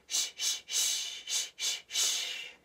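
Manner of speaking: short puffs of "shh", then longer; breathing pushes. Shown as a demonstration. Teacher leading tummy breathing, rhythmic shh. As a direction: push out rhythmic shushes from the tummy. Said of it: "My turn".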